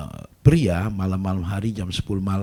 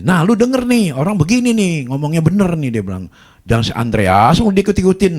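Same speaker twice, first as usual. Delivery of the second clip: much higher, louder told-you-so; loud scolding; 9 semitones higher, 8 dB louder than usual. Retold or imitated. Husband lecturing his wife to listen to the pastor, loud and high. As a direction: rub it in loudly.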